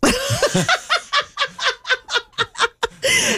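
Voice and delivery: big laugh. Real, not acted. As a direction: break into a real laugh. Co-host laughs at own joke.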